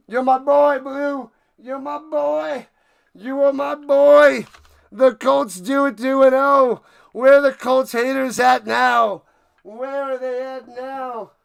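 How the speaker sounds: high, drawn-out victory shouts; cheer, then taunt the doubters; triumphant cheering. Real, not acted. Cheering mascot, taunting the haters.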